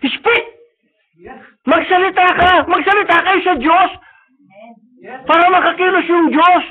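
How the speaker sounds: high, loud command; commanding shout. Acted. God commanding his people to speak, shouting. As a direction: order them to speak out.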